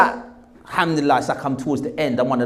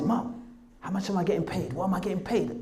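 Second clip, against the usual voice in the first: hushed asking; 2 semitones higher, 10 dB quieter than usual. Retold or imitated. Son privately asking his mum about money, hushed. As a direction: ask softly, keeping it between the two.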